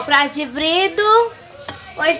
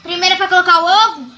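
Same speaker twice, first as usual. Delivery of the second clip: calling out; 4 semitones higher, 7 dB louder than usual. Real, not acted. Calling out to mother.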